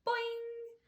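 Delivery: high, springy boing; child's cheeky yell through a mask. A narrator's reading. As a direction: pop it out like a spring.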